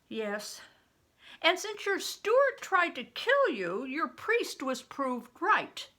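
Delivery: expressive read-aloud to the class; teacher reading a novel to students; story reading. A narrator's reading. Teacher reads chapter aloud.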